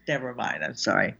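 ordinary delivery

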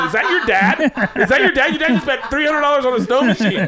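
high, mocking schoolkid chatter; high mock kid voices laughing; teasing kids. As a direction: tease her about her dad. Classmates teasing girl.

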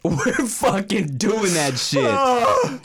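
drawn-out, hyped-up insisting; slow, emphatic insistence. Real, not acted. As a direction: stretch each word out, fired up. Insisting on the tortilla challenge.

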